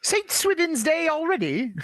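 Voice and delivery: high-pitched, squawking British impression; mock British accent. Co-host teasing the British actor, mock British accent. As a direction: shriek it as a silly British caricature.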